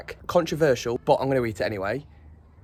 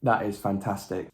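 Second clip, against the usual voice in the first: normal talking voice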